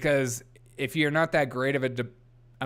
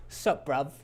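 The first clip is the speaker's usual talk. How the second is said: soft mock British greeting; British accent; 5 dB quieter than usual. Repeating the accent for viewers, mock British. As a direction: murmur a jokey British greeting. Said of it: "that's my British accent".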